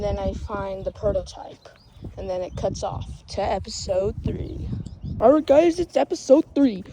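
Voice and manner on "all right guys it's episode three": loud, raised hype intro; high-pitched, punchy video-intro yell; hyped announcement. Pretend. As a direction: announce it big to the crowd. Mock excited announcer intro.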